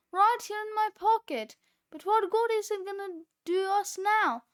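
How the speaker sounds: high, loud and frantic; frantic yell. A narrator's reading. Cheeseball falling through the air, shouting frantically. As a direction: shout it over the rushing wind. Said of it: "screamed Cheeseball".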